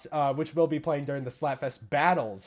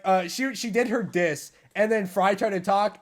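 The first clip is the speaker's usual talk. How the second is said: ordinary talk, nothing marked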